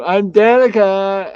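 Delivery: high, loud, slow put-on voice; Danica voice. Showing anybody could play the podcast part, high put-on voice. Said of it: "anybody could do that voice".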